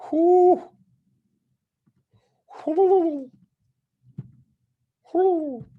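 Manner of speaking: high-pitched owl hoot; owl impression. Shown as a demonstration. Trader showing off his owl call, owl impression. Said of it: "That's my owl impression".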